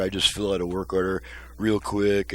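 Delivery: ordinary talk, nothing marked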